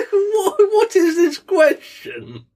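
very high, slow, cracking up with laughter; laughing disbelief. Real, not acted. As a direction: helplessly laugh through the incredulous question. Incredulous at silly question.

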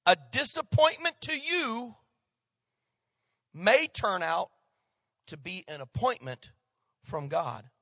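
slow, with long pauses between phrases; slow repeat. Real, not acted. Preacher repeats the saying for note-takers, slow and deliberate. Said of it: "so I'm gonna say it again".

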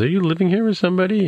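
high-pitched, quick acted question; imitating a surprised wife, pitched well up; retold probing question. Retold wife questions him suspiciously.

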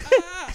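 high startled "Ah"; shocked gasp. Retold or imitated. Comedian at the back reacting to the heckle, shocked gasp. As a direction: gasp in mock horror.

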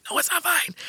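high, sharp correcting mother; stern mom. Retold or imitated. Mother insisting to the cashier, high firm mom voice. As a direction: overrule him like a strict mom. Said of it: "she was like".

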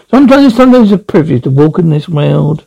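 usual speaking voice

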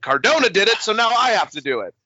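mocking Jericho. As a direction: lampoon his thinking in a silly voice. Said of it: "he was like".

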